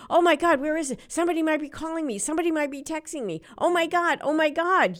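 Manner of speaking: frantic, high and fast, hammed-up phone panic; mock panic. Someone who left their phone at home, mock panic. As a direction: play the lost-phone freak-out big and fast. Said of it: "you go into this angst feeling".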